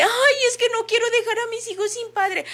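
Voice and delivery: high-pitched, put-on plaintive excuse; mock whining mother. Therapist mimicking a mother who won't leave, high mock whine.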